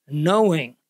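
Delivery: KNOWING set apart by pauses, falling; KNOWING as its own deliberate phrase; emphasis on KNOWING. Shown as a demonstration. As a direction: let KNOWING stand alone, weighted and falling. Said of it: "notice the emphasis on the word".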